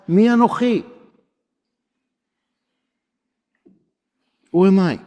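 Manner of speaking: anxious Moses. Acted. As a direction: plead like a frightened shepherd. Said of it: "But he's asking".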